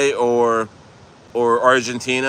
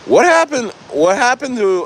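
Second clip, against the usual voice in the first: surprised outcry; 11 semitones higher, 4 dB louder than usual. Real, not acted. Calling out missing mods.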